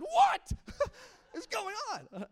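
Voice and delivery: high, loud shocked shriek; shocked disbelief. Retold or imitated. Pastor retelling his reaction to her scream, shocked disbelief. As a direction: blurt it out in comic shock.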